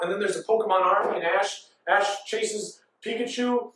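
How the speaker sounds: normal talking voice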